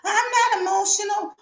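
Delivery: high squeaky mock denial, jumping way up in pitch; mocking quote. Host mocks women who deny emotion, high mock voice. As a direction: parody a woman insisting she's calm in a high prissy voice.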